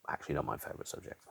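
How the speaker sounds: breathy whispered afterthought; sheepish unvoiced aside. Real, not acted. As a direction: drop to a secret whisper to correct yourself. Self-correcting aside.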